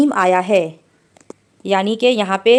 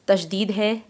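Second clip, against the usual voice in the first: ordinary talk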